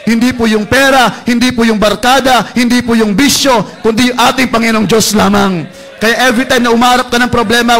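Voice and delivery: loud, fervent altar call; preaching at full force. Acted. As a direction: urge them to turn to God, loud.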